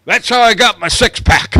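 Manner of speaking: loud, high proud brag; proud boast. Retold or imitated. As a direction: show off the abs to the kids. Athlete proudly showing abs.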